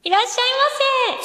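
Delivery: high cutesy anime clerk voice; アニメ声. Shown as a demonstration. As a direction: greet like a sugary anime shop girl. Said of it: "ちょっとアニメ声でいらっしゃいませっていうのをやってみて". (高いアニメ声で)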